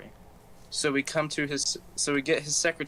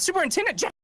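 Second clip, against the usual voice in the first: very high, louder squeal of a stunned teacher; shocked shriek; 4 dB louder, much faster than usual. Retold or imitated. Teacher squeals on hearing the superintendent was called. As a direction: shriek the name in disbelief.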